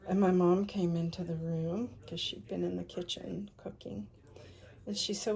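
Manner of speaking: ordinary talk, nothing marked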